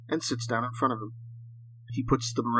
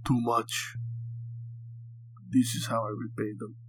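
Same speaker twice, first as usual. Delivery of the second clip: put-on captive with a heavy Russian accent; thick Russian accent; 2 semitones lower, 5 dB quieter than usual. A narrator's reading. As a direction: speak English like a Russian prisoner. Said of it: "his thick Russian accent coming through".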